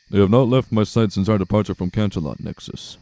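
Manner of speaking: steady soldier reporting, quick; gruff earth pony. Acted. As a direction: answer like a loyal guard.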